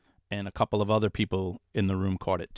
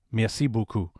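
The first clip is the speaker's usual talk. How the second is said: put-on French accent. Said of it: "somebody with a French accent say it back".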